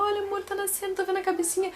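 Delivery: high, moved husband voice; husband's words. Retold or imitated. Husband tells his wife he sees the head, high and moved. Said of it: "meu marido começou a chorar e falou".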